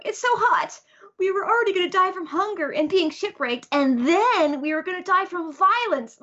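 high, whiny little-cat voice; Cat Viper. Retold or imitated. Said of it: "Cat Viper's going".

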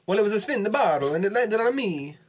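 cartoonish high Italian accent. Player explaining the bottle spin in a mock Italian accent. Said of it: "Straight up Mario Luigi".

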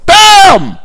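sudden loud high shout; shouted bam. Acted. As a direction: slam the word like a blow. Sudden catastrophe exclamation.

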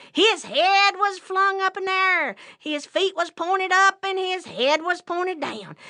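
high, loud play-by-play of the mishap; excited storytelling. A narrator's reading. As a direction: call it out high, phrase by phrase. Trap springs on the rabbit.

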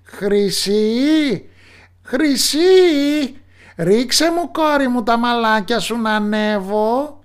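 high, loud put-on witch call; young man mimicking the witch, calling up high; prince faking the witch. Prince imitates the witch calling up to the tower. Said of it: "Άλλαξε τη φωνή του τάχα μου πως είναι η μάγισσα".

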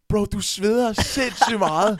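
high, slow, loud retelling; amazed exclamation. Retold or imitated. Guest to sweaty performer on stage, loudly amazed. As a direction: exclaim it with drawn-out emphasis.

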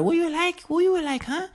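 very high, squeaky mimicry; waitress impression. Waitress taking their order, high-pitched impression. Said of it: "she gets our order".

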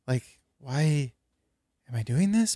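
lightly mimicked halting question, lifting at the end; Jeff Goldblum. Showing co-host the actor's speech, "this" lifted at the end. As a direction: pause after "why", let "this" go up. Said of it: "everything goes up at the end".

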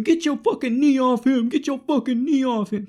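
high-pitched mock scream of outrage; imitated screaming. Woman taking the video screams at police, high mock scream. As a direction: yell it high, imitating a frantic bystander. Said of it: "she's screaming".

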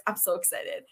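quiet, contained excitement; giddy aside. Real, not acted. Host confessing excitement before bringing on a guest, softly giddy. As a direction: say it softly, holding the giddiness in. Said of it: "go back to my giddiness".

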